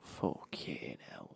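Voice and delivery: low, drawn-out "fucking hell"; dismayed muttered curse. Retold or imitated. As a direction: let it sink slowly in disbelief. Retold stunned reaction in shop.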